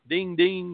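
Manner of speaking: ping it out like a phone notification; imitated phone ding. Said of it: "my phone's going ding".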